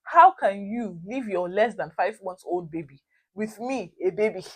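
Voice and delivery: normal talking voice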